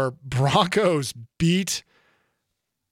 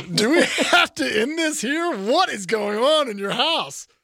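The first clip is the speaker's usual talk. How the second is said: laughing disbelief; 8 semitones higher than usual. Real, not acted. Smoke alarm going off nearby.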